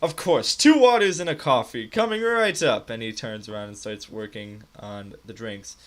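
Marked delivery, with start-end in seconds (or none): 0.0-2.9 loud, high-pitched cheery order-taker; eager barkeep. Acted. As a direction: call the order back brightly, loud and high. Game master voices the eager barkeep.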